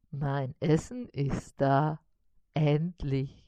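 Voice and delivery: low, slow, contented spider voice; pleased spider. A narrator's reading. Spider glad his meal arrived, low slow delight. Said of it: "freute sich Karl-Heinz".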